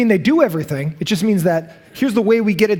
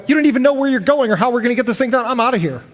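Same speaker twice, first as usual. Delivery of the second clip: higher, fast mock complaint; fed-up protest; 4 semitones higher, faster than usual. People quitting on a leader with no plan, higher and fed up. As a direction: rattle it off and walk out.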